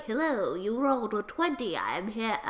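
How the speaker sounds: low, mock-suave entrance; lightly voiced suitor. Kurt jumps out of the bushes, mock-suave low voice. Retold Kurt introduces himself.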